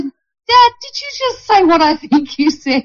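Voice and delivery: high-pitched mock-incredulous question; amused disbelief. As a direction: retell it as an astonished, amused daughter. Retells surprised question to father.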